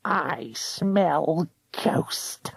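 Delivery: very slow whispered hag voice; eerie whisper. A narrator's reading. Ghost hunter sensing ghosts, slow whisper. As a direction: breathe it out slowly, hungry. Croker sniffing prey, whispering.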